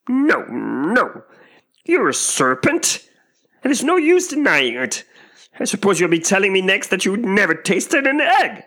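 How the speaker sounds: high, loud, scornful bird voice; scoffing, indignant pigeon; contemptuous pigeon. A narrator's reading. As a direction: pile up accusations in a screech. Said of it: "said the pigeon in a tone of the deepest contempt".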